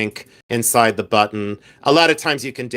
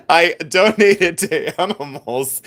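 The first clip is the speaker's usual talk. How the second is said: slowed, higher, through laughter; laughing; 4 semitones higher, slower than usual. Real, not acted. Seller correcting "I did animals", laughing.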